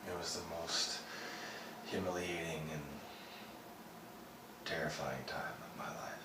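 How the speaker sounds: quiet, low recollection with heavy pauses; subdued, haunted. Acted. Survivor telling of a scarred face, subdued and haunted. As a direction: recall it softly, as if it still hurts. Recalling a humiliating, terrifying burning.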